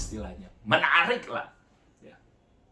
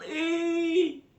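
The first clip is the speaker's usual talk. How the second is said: high-pitched cry of the name, echoing her call; imitating a desperate cry. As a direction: call the name out like a broken woman. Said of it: "Dia bilang". (tiruan panggilan putus asa bernada tinggi)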